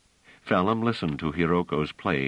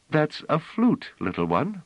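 lightly voiced gentle woman, higher; a hint of a tender musician; kindly woman; 11 semitones higher than usual. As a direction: answer a child kindly. Musician teaching child.

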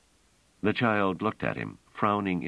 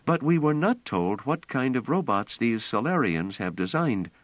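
higher-pitched, insistent story voice; earnest, raised-pitch character dialogue; earnest woman character, urgent; 8 semitones higher than usual. A narrator's reading.

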